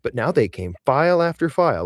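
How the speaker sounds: plain reading voice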